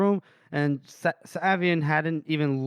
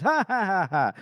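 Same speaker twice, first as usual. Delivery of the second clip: cronies' laugh; 4 semitones higher than usual. Retold or imitated. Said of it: "and his, his cronies".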